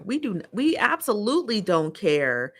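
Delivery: usual speaking voice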